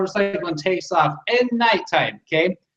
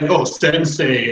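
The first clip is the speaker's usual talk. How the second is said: mocking; 3 semitones lower, much slower than usual. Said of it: "mocking everything about it".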